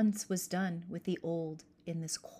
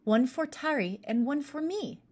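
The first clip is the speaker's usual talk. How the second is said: put-on higher, eager girl delighted with a gift; exclaiming; 6 semitones higher, 4 dB louder than usual. Merja to her siblings about the needle cases, excited. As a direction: gush over the present. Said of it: "exclaimed Merja".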